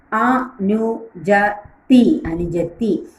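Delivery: അനുജത്തി broken into slow syllables; teacher sounding out a word for the class; slow syllable drill. Shown as a demonstration. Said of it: "teacher drills syllable by syllable".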